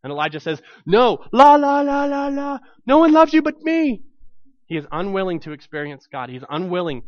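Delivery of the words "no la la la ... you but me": much higher sing-song to drown God out; childish la la la. Acted. Elijah refusing to hear God, childish sing-song. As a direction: cover your ears and sing over him.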